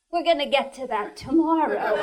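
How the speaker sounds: quick, dismissive contractor brush-off; breezy contractor. Acted. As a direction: rattle it off fast. Contractor quoted in joke.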